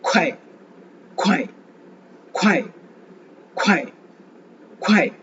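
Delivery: "குவாய்" with a hard, breath-blasted k and falling pitch; forceful puff of air. Shown as a demonstration. Teacher drilling Chinese pronunciation, forceful aspirated "குவாய்". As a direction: push air out fast on the k of "குவாய்", dropping sharply. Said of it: "காத்து வேகமா அழுத்தமா".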